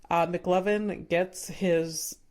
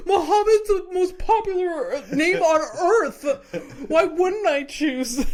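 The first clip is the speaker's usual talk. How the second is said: high, loud shout through giggles; laughing mock shout; 9 semitones higher, 6 dB louder than usual. Friend retells McLovin yelling back, laughing.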